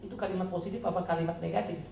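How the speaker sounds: ordinary talk, nothing marked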